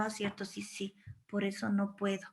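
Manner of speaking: soft, low, defeated mumble to oneself; resigned agreement. Acted. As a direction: give in quietly, as if believing the accusation. Acting out giving in to accuser.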